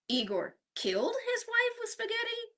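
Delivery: KILLED hit hard, rest held high in disbelief; stress on killed. Shown as a demonstration. Teacher showing how stress changes meaning, killed stressed as question. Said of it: "changing the stress in each".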